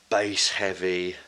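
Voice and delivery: usual speaking voice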